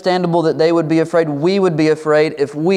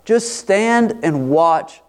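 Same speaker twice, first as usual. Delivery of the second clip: slow each word, calm after the peak; slow and measured; 4 semitones higher, much slower than usual. Retold or imitated. Moses calming the people, slow and steady. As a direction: settle into a slow, steady assurance. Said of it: "he's like".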